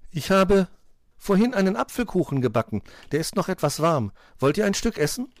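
put-on high-pitched fairy-tale girl; friendly Schneewittchen. A narrator's reading. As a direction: offer it sweetly in a high voice. Schneewittchen offers cake.

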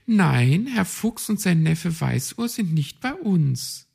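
friendly but firm; lightly voiced receptionist. A narrator's reading. Receptionist thrush answers the raven, polite.

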